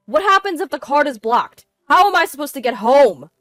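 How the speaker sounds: loud, high-pitched alarm; panicked pleading. A narrator's reading. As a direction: plead frantically about getting home. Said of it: "His voice shaking, he pleaded".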